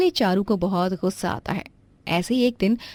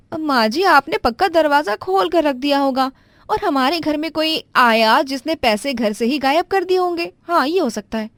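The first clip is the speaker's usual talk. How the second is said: pitched well up, flustered daughter-in-law apologizing; a higher young wife caught out, stammering; 7 semitones higher, 5 dB louder than usual. Acted. Daughter-in-law blames a thief.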